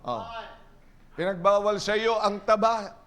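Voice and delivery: ordinary talk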